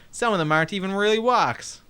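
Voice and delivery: ordinary delivery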